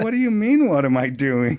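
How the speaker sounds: puzzled. Retold or imitated. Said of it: "I said".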